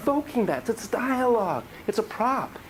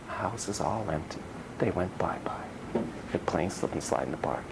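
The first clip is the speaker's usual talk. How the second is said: hushed whisper; 12 semitones lower, 7 dB quieter than usual. Acted. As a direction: whisper it like a sad secret. Empty house, family gone.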